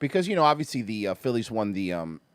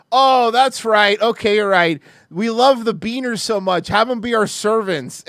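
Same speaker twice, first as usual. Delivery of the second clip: slow, louder mock-agreeable sarcasm; sarcastic agreement; 3 semitones higher, 6 dB louder, slower than usual. His usual retort to patronizing people, slow loud sarcasm. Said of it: "so my response always is like".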